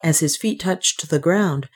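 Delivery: usual speaking voice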